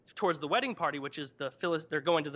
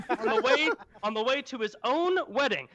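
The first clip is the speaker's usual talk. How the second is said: laughing; 7 semitones higher, 4 dB louder than usual. Real, not acted. Laughing at honey image.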